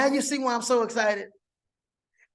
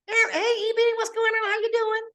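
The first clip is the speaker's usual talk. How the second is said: high, lively imitation of a friend; Boomy Moore voice; 13 semitones higher than usual. Imitating friend Boomy answering his call, high and chirpy. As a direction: mimic her chirpy phone greeting, way up high. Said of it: "my Boomy Moore voice".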